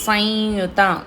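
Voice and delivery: సంయుత with the ం as a nasalized vowel; nasal sound in samyuta. Shown as a demonstration. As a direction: sound సంయుత through the nose. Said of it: "నాజిల్ sound అండి". (సంయుత లో సున్నా ముక్కుతో పలకండి)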